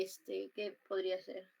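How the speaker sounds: usual speaking voice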